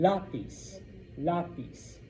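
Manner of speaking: "Lapis" as LA-pees, stress up front; first-syllable stress. Shown as a demonstration. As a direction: hit the LA in "Lapis". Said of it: "It's lapis".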